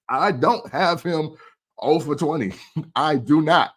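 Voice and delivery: giggling while counting the score; laughing. Real, not acted. Laughing while scoring [name]'s wins.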